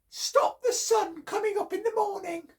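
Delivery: very high squeaky demand; chipmunk voice. Acted. Chipmunk setting Bear a task, very high. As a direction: squeak out the challenge. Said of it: "And Chipmunk said".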